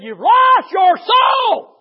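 slow, very loud, high shouted verdict; preacher booming the punchline of his story; thundering shout. Retold or imitated. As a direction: drag it out and thunder it. Retold preacher's climactic line.

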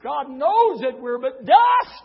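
slow, loud, high preacher's cry; preaching at full force. Acted. Preacher crying out to the church. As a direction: build it up and thunder it.

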